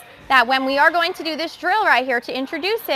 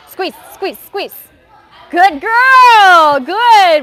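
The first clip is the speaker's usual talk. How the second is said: higher and louder, cheering her on; excited coaching calls; 5 semitones higher, 10 dB louder, much slower than usual. Real, not acted. Coach cheering a gymnast through her roll, loud and bright. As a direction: push her through then praise. Cue then loud praise.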